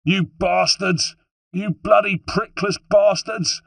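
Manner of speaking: high, slow, drawn-out yell; furious, stretched-out shout. A narrator's reading. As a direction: hurl the insults across a courtyard. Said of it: "Holden shouting".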